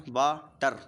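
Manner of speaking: "वाटर" split into two beats, pausing after the first; syllable by syllable. Shown as a demonstration. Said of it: "सबसे पहले वा निकलेगा". ("वाटर" को दो हिस्सों में तोड़कर)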